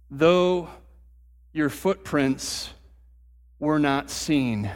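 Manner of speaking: slow, solemn reading of the psalm; scripture reading. Acted. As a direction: read it slowly, letting each line settle.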